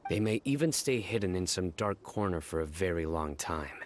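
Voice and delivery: ordinary talk, nothing marked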